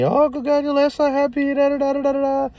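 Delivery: very high sing-song congratulations; imitating cooing ladies in the waiting room; gushing ladies. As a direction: mimic chirpy strangers fawning. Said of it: "they're telling me".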